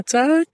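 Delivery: high, sudden "τσακ" snap; sharp tsak. Pretend. Sudden sound effect. (απότομο ψηλό «τσακ»)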